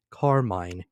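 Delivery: ordinary delivery, nothing marked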